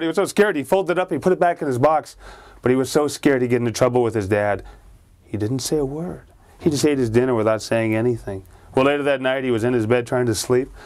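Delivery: plain reading voice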